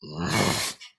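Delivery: rough snorted exhalations; imitated Godzilla breathing. Showing Godzilla breathing out his nose, imitated snorts. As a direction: snort out like a giant beast. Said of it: "breathes, he goes".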